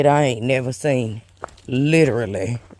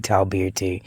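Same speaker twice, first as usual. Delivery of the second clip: ordinary delivery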